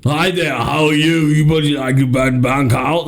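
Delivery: hammed-up Austrian accent as a banker; Austrian-accented banker politely offering service. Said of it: "He's speaking Austrian again".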